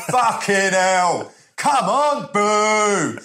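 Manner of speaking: high, drawn-out heckles; audience jeering. Retold or imitated. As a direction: heckle the screen like a fed-up crowd.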